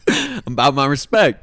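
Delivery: teasing the dad's respect line; mock dad boast. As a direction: puff up with pride. Mocking dad about respect.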